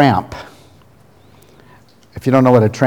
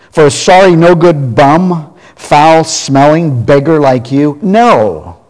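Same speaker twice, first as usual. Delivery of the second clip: loud, higher, slow contemptuous snap at a beggar; snapped; 3 semitones higher, 4 dB louder, slower than usual. Acted. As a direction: spit out every insult, then slam it shut. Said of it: "she snapped".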